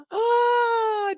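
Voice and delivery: sudden loud high shriek of joy; a fan squealing with joy; high squeal of delight. Real, not acted. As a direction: let out a thrilled squeal. Thrilled by the actor's scene.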